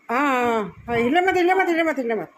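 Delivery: high, loud "हिले मत" repeated; alarmed call to stay still; urgent warning. Real, not acted. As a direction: stop him moving at once. Telling hurt child to keep still.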